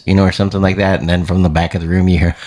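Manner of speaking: normal talking voice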